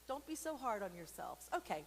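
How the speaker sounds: usual speaking voice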